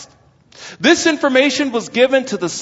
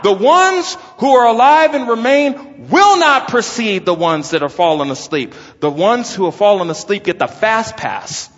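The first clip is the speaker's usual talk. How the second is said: ordinary talk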